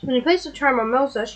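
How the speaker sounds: normal talking voice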